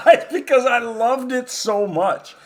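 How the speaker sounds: laughing through the words; chuckling while talking. Real, not acted. Laughing while telling story.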